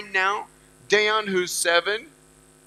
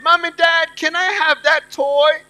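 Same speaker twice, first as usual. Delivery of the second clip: higher, louder mock little-kid request; pleading child; 6 semitones higher, 5 dB louder than usual. Child asks parents for a toy, pleading. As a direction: pitch it up like a child begging parents.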